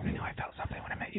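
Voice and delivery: fast breathy joke whisper. Pretend. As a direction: whisper it like a sweet nothing. Flirty joke to co-host.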